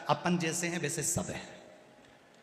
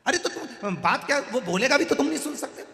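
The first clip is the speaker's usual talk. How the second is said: laughing mockery; 11 semitones higher, 6 dB louder than usual. Grandson mocking the deaf man.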